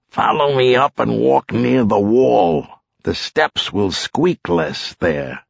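lightly voiced lead officer; giving careful orders, slowing at the end; cop in command. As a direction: instruct your partner at the stairs.

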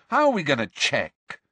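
challenging demand. A narrator's reading. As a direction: press hard for an answer. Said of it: "Harry demanded".

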